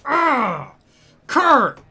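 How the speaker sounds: sudden loud spluttering yelp; choking shout while spitting; spit take. Real, not acted. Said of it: "Oh, I just spat everywhere".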